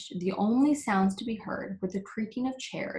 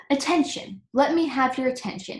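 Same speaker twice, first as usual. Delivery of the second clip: insistent; 6 semitones higher, 5 dB louder than usual. A narrator's reading. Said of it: "insisted the king".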